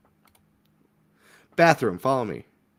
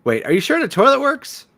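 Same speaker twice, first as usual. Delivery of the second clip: much higher, louder alarmed question; alarmed doubt; 12 semitones higher, 6 dB louder than usual. Acted. Guest asking the host whether the filthy toilet works, alarmed. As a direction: ask it in disbelief.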